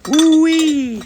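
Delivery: loud, high rising "woo-wee"; high whoop. Real, not acted. Streamer marvelling at an angry Scouse accent, whooping.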